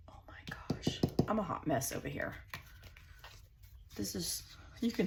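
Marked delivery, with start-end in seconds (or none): 0.1-1.0 whispered to herself. Real, not acted. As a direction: breathe it out under the breath. Reacting to her messy layout.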